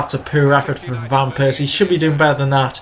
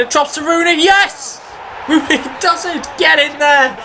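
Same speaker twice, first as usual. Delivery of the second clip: high-pitched, elated football cheer; goal celebration shout; 11 semitones higher than usual. Real, not acted. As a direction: cry out as the ball goes in. Celebrating his goal as it goes in.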